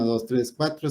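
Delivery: ordinary talk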